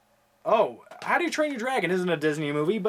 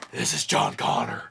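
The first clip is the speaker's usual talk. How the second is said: whispered, deep-set movie quote; gravel-free whisper. Retold or imitated. Vlogger quoting John Connor in a whispered voice. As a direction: breathe it like a radio message.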